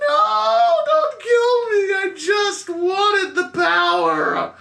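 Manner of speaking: very high, slow, tearful wail; pleading old lady. Acted. Beaten old lady begs for her life, wailing. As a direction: wail and beg like a frail villainess. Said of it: "the little old lady ... She goes".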